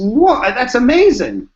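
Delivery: high, loud and slow whoa; doctor won over by the book: slow, loud, high amazement; awestruck. Retold or imitated. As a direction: drag out the wonder, pitch way up.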